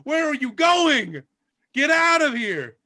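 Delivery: loud exasperated host throwing them out; angry maitre d'. Acted. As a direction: yell at the pigs to leave. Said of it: "The maitre d' says".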